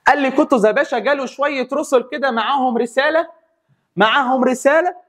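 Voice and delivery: high, animated telling; lively storytelling. Real, not acted. Teacher telling students about Qutuz, excited and animated. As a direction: spin the tale with excitement.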